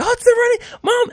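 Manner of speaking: put-on very high squeal of a child spotting him; high-pitched child voice, thrilled; excited kid. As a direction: squeal like a kid who saw a celebrity. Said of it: "they call me the running guy".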